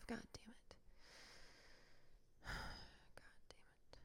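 sighing, barely audible whisper; whispered cursing. Real, not acted. Podcaster cursing to herself over being a housewife, whispered. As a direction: whisper it to yourself in frustration.

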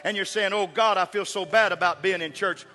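loud, high, building sermon climax; preaching at full force. Acted. Preacher rousing the congregation to keep coming. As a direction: preach it out with rising fire.

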